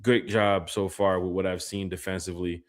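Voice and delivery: ordinary talk, nothing marked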